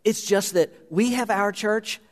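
impassioned preacher, high and driving; preaching at full force. Acted. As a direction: preach it hard to the congregation. Preaching a story to congregation.

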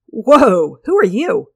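startled shout. Acted. Stranger suddenly appears.

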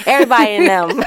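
quick words through a laugh. Real, not acted. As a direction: laugh as you say it. Joking about sister girls.

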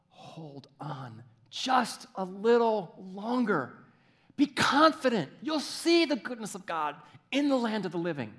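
impassioned urging. Acted. God urging believers to keep waiting, fervently. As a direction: rise into fervent encouragement.

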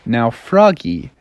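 usual speaking voice

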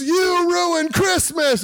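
high, slow, drawn-out accusing yell; brother's mock outburst. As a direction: cry it out like an upset brother, hammed up. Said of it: "And my brother goes".

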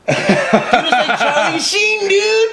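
laughing, high-pitched. Real, not acted. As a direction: squeal it through laughter. Laughing at Charlie Sheen joke.

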